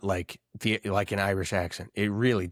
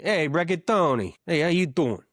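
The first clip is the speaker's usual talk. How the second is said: mock Italian-American; 5 semitones higher than usual. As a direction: greet him like a Jersey wiseguy. Greeting imagined Italian-American guy.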